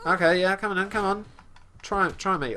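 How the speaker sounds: game-fight bravado; playful taunting. Pretend. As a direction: egg the enemy on in mock challenge. Taunting an enemy in game combat.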